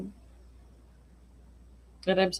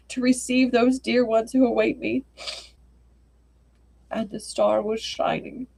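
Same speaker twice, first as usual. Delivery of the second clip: gentle storyteller reading aloud; story narration; 2 semitones higher than usual. A narrator's reading. Streamer reads a sad story to her viewers, gently. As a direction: read it like a bedtime tale.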